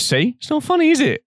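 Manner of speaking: squeaky high mock taunt; mocking comeback. Teasing short friend Louis with a high mocking voice. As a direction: jab back in a squeak. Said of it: "And I went".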